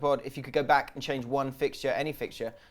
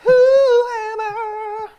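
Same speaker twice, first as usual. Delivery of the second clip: announcer voice; 12 dB louder, much slower than usual. Acted. Jingle-style quiz segment call.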